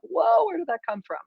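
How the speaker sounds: high, put-on parent, startled; mock parent voice. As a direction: imitate a parent taken aback. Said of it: "your parents are like".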